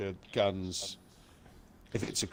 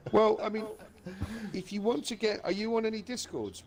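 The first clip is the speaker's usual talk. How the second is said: high voice; 10 semitones higher than usual. Real, not acted. High excited voice.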